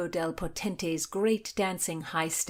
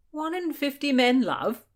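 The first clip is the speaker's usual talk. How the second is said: higher, louder disbelieving question; incredulous; 9 semitones higher, 6 dB louder than usual. A narrator's reading. William to his brother, higher incredulous question. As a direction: repeat the number in disbelief.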